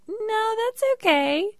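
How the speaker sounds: very high, slow, sugary-polite voice; a squeaky, overly polite no thanks; high polite decline. Retold or imitated. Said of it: "And I'm like".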